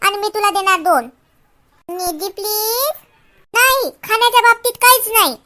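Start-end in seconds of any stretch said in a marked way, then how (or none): 1.9-2.9 soft, drawn-out begging; pleading. Acted. Friend begs Nidhi for fries, soft slow plea. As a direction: beg softly, stretching the please.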